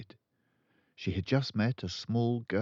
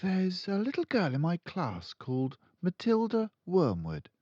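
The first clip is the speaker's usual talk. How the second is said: Miss Honey beginning; 10 semitones higher than usual. A narrator's reading. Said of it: "Miss Honey began".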